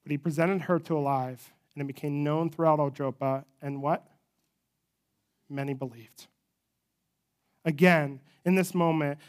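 usual speaking voice

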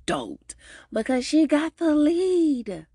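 thrilled retelling; stoked. Real, not acted. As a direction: ride the excitement up on stoked. Gushing over Maxine's joy.

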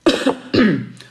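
loud clearing of the throat. Real, not acted. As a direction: clear your throat before going on. Pauses to drink water.

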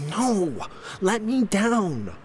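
put-on high bratty boy voice; whining little boy. A narrator's reading. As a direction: squirm and protest like a kid. Child squirming in arms.

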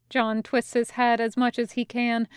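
ordinary delivery

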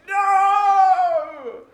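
high shouted wail of despair; real-sounding cry of no; anguished cry. Acted. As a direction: scream it like you're doomed.

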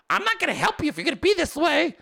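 high, quick mock wife voice; imitating upset wife. Wife threatening not to help unload, high quick mock huff. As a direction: rattle it off high, mimicking the wife. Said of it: "She's like".

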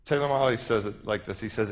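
ordinary talk, nothing marked

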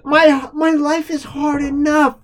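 high, slow, loud mock whine; mock Karen. Host parodying the letter writer, high and whiny. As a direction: wail it like a dramatic Karen. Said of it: "fucking Karen way".

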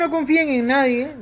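deadpan moral of the story, said with a wink; mock warning. Friends wrapping up the story with a mock warning to listeners. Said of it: "en resumen".

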